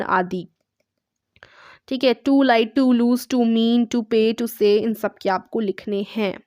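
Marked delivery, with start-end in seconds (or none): 2.3-4.6 slow dictation. Shown as a demonstration. Teacher dictates verb list.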